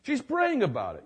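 amazed man. Retold or imitated. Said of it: "He goes".

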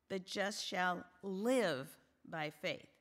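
slow down and lean hard on live; stress on live. Shown as a demonstration. Preacher quoting Hebrews to the women, stress on live. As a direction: hold live long and punch it. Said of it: "the emphasis is on live".

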